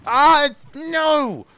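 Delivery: high, loud cry of no; frustrated shout. Real, not acted. Gamer killed in the game, frustrated shout. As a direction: yell it out in dismay.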